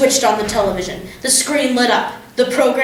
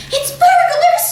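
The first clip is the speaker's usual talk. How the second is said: hissed; 5 dB louder, slower than usual. A narrator's reading. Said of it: "hissed the mother".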